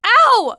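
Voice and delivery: yelp of pain. Acted. Passenger hurt in crash.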